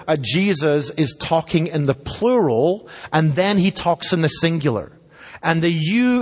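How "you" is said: "you" jumps up high with a push; emphatic you. Shown as a demonstration. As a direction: lift "you" well above the rest. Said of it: "is emphatic".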